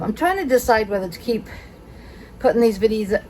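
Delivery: panting through the sentence; out of breath. Real, not acted. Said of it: "Sorry, I am out of breath".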